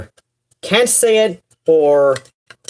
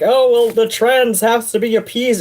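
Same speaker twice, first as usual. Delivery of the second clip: mocking complainers; 5 semitones higher than usual. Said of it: "people going on about".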